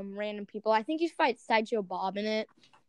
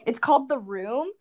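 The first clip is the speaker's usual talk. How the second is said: ordinary talk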